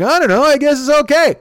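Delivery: Dwyer quote. Retold or imitated. Telling the guys how Dwyer shrugged, high mock voice. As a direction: lightly mimic Dwyer shrugging it off. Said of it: "He's like".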